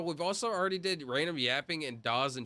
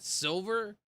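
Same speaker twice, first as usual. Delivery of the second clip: normal talking voice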